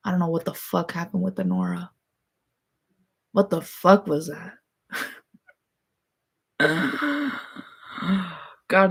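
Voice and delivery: ordinary talk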